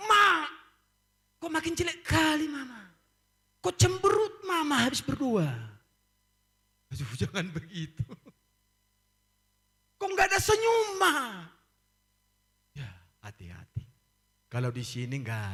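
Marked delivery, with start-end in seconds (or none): 0.1-5.7 put-on loud, high child complaining to mama; child's voice. Child shouting at mother. 10.0-11.4 put-on high, loud, crying child's whine; whining child.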